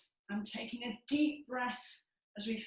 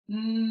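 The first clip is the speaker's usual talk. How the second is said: louder steady hum; humming; 8 dB louder than usual. Shown as a demonstration. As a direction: hum again with lips closed. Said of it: "And again".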